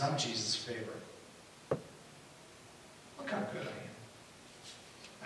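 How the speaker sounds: soft, low musing to oneself; lightly voiced smug inner thought; quiet self-satisfied boast. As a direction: murmur the boast privately, as if thinking it. Preacher voices Peter's boastful self-image.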